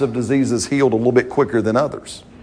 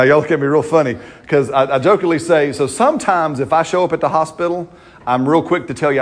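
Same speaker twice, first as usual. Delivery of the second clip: normal talking voice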